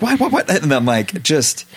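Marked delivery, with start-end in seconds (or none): 0.0-0.5 confused wife. Retold or imitated. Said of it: "And she'll be like".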